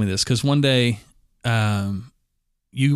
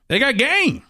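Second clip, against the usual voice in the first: high-pitched kid imitation; little kid's accent and voice; 11 semitones higher than usual. Eight-year-old with basketball asks speaker, imitated kid voice. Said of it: "the accent and voice that he had".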